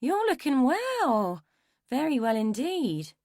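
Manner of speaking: slow, high, swooping woman's voice; drawn-out, showy compliment; appreciative appraisal. A narrator's reading. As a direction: size someone up approvingly, stretching the words. Woman appraises man in story.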